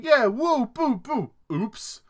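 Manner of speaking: put-on high, loud childish whoops; silly boyish cheers fading to oops; mock kid playing. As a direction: play a careless kid firing guns. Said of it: "he's going".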